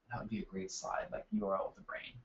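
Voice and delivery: very soft, low, half-whispered note to self; muttered aside. Real, not acted. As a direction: drop right down as if thinking aloud. Aside to self about slide idea.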